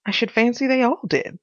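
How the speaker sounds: usual speaking voice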